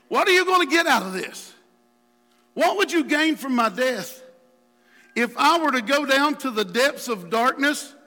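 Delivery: preacher acting out a heated plea; David challenging God. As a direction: demand answers from God. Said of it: "David has this conversation with God".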